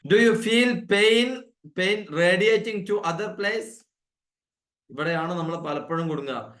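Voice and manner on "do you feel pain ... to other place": slow and higher, clearly spaced words; slow model question. Shown as a demonstration. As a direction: read the question out slowly like a teacher's model. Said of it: "question expressions വേണേ".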